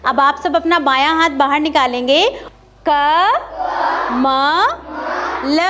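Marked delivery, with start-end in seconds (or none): 2.9-5.6 क, म, ल said apart with pauses; sound by sound. Shown as a demonstration. Teacher sounds out letters one by one for children. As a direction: sound out क म ल separately. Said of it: "भिन्न भिन्न ध्वनियों को जोड़कर".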